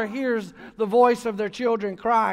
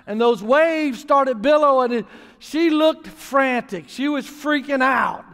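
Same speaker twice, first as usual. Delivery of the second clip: ordinary talk, nothing marked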